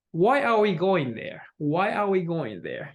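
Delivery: "why" starts up, "there" goes down; falling intonation. Shown as a demonstration. Teacher models a why question, falling intonation. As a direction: ask it with the pitch falling to "there". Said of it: "You know, goes down".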